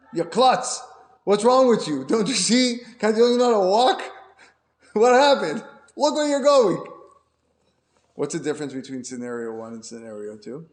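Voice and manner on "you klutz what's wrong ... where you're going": loud, higher, furious scolding; angry scolding cry. Acted. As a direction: yell at him for being clumsy. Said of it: "She cries out".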